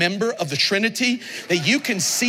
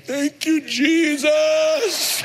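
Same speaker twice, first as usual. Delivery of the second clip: higher, exultant thank you Jesus; joyful praise; 7 semitones higher, much slower than usual. Retold or imitated. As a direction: cry it out with hands lifted. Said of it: "lift my hands and go".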